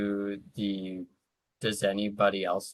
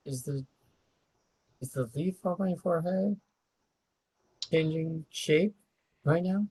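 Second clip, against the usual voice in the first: in-character question; 10 semitones higher than usual. Acted. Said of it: "that's me asking the group, like, just in character".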